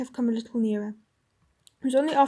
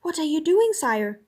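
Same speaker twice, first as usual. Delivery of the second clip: higher, louder alarmed question; sharp question; 4 semitones higher, 4 dB louder, slower than usual. Acted. Jill alarmed at the drawn sword, sharp and louder. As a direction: snap it out in alarm. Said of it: "said Jill sharply".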